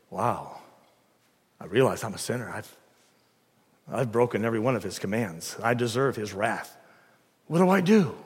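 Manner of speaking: low, soft realization; subdued confession. Acted. Person hearing the gospel confesses, low and subdued. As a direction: confess it low and quietly, with pauses. Voices a convicted sinner.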